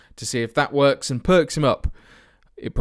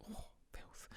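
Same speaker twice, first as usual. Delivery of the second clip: breathy whispered oh, filth; whispered curse; 32 dB quieter than usual. Real, not acted. Vlogger privately cursing his own lineup, in a whisper. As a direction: breathe it out under your breath. Muttered reaction to team sheet.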